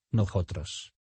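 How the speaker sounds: «nosotros» with a soft J for the S; S como J suave en «nosotros». Shown as a demonstration. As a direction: say nosotros as «nojotros», soft aspirated S. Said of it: "suena como una J suave". («nosotros» con jota suave)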